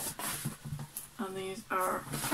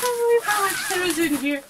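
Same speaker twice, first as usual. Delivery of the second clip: higher and louder, amazed; surprised at how full the bag is, pitched up; 10 semitones higher, 9 dB louder than usual. Real, not acted. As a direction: disbelief at how much is packed in.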